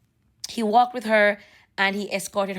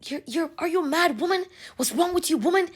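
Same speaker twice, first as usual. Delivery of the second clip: imitated Bahamian accent, pitched up; 6 semitones higher than usual. Man scolds his ex, imitated Bahamian accent. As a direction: mimic his exasperated Bahamian accent, higher. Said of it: "he's talking in the Bahamian accent".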